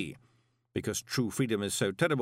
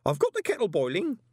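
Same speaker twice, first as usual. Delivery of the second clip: put-on much higher old man's quaver; 10 semitones higher, 4 dB louder, faster than usual. A narrator's reading. Old Groat soothes Moist with a much higher quaver. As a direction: offer tea with a trembling voice. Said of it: "Groat quavered".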